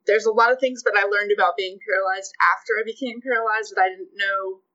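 usual speaking voice

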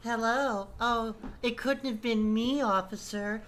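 sweet put-on girly voice, giggling; innocent girly voice. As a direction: play the innocent girl caught by police. Playing innocent girl to officer.

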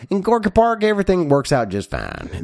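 a folksy fake Texan explaining; mock Texan accent. Host playing a Texan in Gorky Park, mock Texan accent. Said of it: "we're from Texas".

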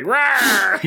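hammed-up high roaring rawr; zombie roar. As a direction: roar it big like a cartoon zombie. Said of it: "he's making zombie noises".

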